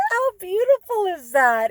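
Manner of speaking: high, loud and thrilled; delighted. Real, not acted. Wife gushing over husband's surprise salad, delighted. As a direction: gush about the surprise.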